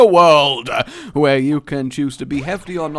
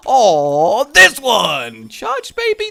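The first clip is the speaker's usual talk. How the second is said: mock death yell; 8 semitones higher, 8 dB louder, much slower than usual. Mock dying yell in game.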